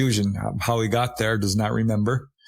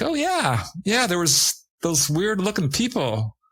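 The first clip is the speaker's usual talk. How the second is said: high, airy mock friend's reply; breezy friend; 7 semitones higher than usual. Friends casually confirming the beach walk, high and breezy. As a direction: toss it off lightly as a carefree friend.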